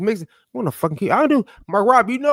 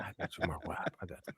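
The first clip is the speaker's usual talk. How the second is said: hushed reassurance; 10 semitones lower, 19 dB quieter, much faster than usual. Retold or imitated. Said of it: "I said".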